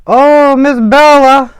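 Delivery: loud surprised call to the cat. Real, not acted. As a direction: shout for the cat to come. Calling out to the cat.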